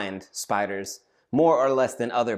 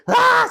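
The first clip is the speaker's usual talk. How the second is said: high, loud shriek; student screaming at a spider in the shower; terrified scream; 9 dB louder than usual. Retold or imitated. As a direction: scream at the top of your lungs. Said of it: "starts screaming, top of his lungs".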